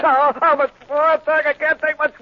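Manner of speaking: high, loud, slow agonised crying out; howling plea during the eye operation; anguished wail. Acted. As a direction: stretch out the agony. Patient near the eyes.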